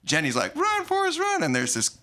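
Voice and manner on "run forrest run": Jenny calling out. Retold or imitated. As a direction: imitate a far-off cry in a high voice. Jenny's famous cry acted out.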